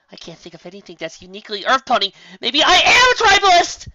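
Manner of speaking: climbing to a high, loud wail; despairing high cry; dismayed wail. Acted. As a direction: spiral into comic despair. Dismayed self-doubt.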